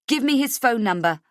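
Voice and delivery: urgent demand. A narrator's reading. Desperate mother demanding number.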